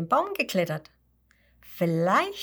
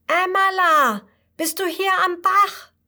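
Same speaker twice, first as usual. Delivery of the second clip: calling out much higher and louder; 12 semitones higher, 8 dB louder than usual. Acted. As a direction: shout her name across the stream. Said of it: "ruft Huberto ganz laut".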